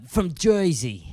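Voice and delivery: drawn-out mock Jersey accent; New Jersey accent. Podcaster slips into Jersey accent for his story, hammy accent. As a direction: lean slowly into a put-on Jersey twang. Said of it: "More impressions, please".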